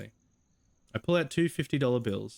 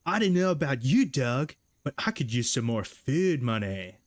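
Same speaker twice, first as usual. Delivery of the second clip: Doug's voice; 2 semitones higher, 4 dB louder than usual. Acted. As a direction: recall the goofy Doug voice. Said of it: "what was his voice again?".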